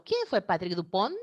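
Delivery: "¿Quién fue Patrick Dupont?" rising at the end; question intonation. Shown as a demonstration. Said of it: "¿Me escuchan de hablar de esa manera?".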